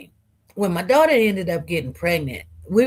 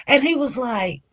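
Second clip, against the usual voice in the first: ordinary delivery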